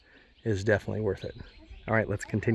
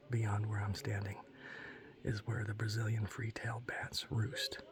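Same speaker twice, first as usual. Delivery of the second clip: whispering; 7 dB quieter than usual. Real, not acted. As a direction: whisper like a hushed tour guide. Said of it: "I have to whisper".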